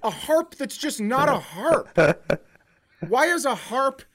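normal talking voice

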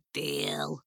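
low, soft, drawn-out imp voice; sly imp sealing it softly; little imp. Acted. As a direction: relish the bargain, low and quiet. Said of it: "said the little imp".

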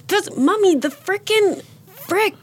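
high, mock little kid scolding; high kid voice with a giggle; toddler voice. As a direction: play the small son correcting mom. Child voiced in a story.

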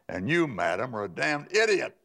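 retold telling-off with comic force; indignant retort. Retelling his retort to caller.

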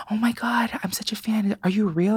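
put-on high, hushed young fan voice; high whispery awe; starstruck kid. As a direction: gush like a twelve-year-old fan. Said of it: "He goes".

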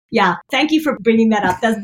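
usual speaking voice

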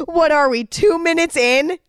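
high, teary question with a rise on two; choked-up disbelief. Real, not acted. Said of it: "I'm about to start crying".

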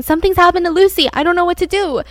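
retelling a friend's frantic call for help; freaking out. Retold or imitated. Friend calls mom overseas, panicked and loud. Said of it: "freaking out".

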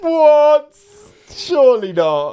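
loud, high cry of disbelief; astonished. Real, not acted. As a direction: burst out in sheer surprise. Reacts to surprising claim.

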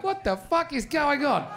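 high, slow disbelief; stunned at how small the world is; high, slow baffled outburst. Retold or imitated. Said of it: "I'm like".

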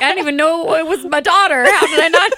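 high, loud, laughing imitation of embarrassed dads; laughing mock dads. Imitating embarrassed new dads to a friend, laughing and high. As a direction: squeal it through laughter as flustered dads.

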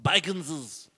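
slow, high, strange creature; movie creature voice. Acted. Comic parroting a film word, odd creature voice.

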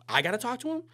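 high, loud disbelieving question; can't believe it's your job; incredulous. Retold or imitated. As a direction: photographer told to talk to Tom, high and loud in disbelief. Disbelief at being sent.

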